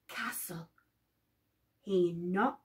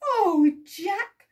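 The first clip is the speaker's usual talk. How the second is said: overjoyed cry; 5 semitones higher, 6 dB louder than usual. Acted. Mother overjoyed.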